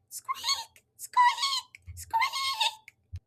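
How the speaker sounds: very high squeaking; squeaky wheel noise. Acted. The wheelbarrow rolls to the library, high squeaks.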